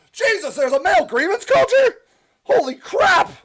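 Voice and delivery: loud, high-pitched disbelief; astonished. Real, not acted. Streamer reacting to Judy's comment, loudly astonished. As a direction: burst out incredulous, pitch way up.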